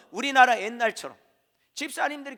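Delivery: ordinary talk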